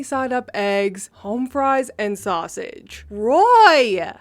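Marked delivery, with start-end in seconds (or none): 3.1-4.1 much louder shocked yell of the name; scream. Retold or imitated. Wife screaming at her husband as eggs hit the server. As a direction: yell his name as the plates fly. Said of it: "the woman screamed".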